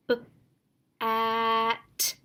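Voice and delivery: B and at said as separate sounds; student sounding out B-at; segmented sounds. Shown as a demonstration. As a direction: split bat into B-at. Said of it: "Student responds".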